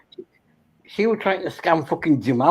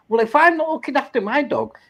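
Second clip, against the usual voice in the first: incredulous retort; 9 semitones higher than usual. Retold or imitated. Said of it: "and I'm thinking".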